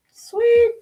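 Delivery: high loud squeal of delight; excited squeal. Real, not acted. Squealing with delight at a card she just drew. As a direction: squeal it with glee.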